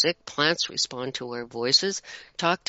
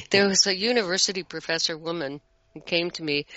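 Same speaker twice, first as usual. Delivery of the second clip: ordinary delivery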